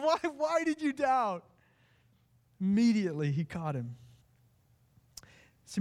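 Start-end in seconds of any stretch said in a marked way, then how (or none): 0.0-1.4 much higher, drawn-out heartfelt why; anguished plea. Acted. Jesus pleading with Peter after he sank, high and aching. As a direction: ache over the word why, slowly. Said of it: "because inflection matters".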